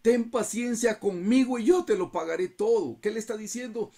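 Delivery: high, loud desperate pleading; begging servant. Acted. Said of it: "le rogaba diciendo".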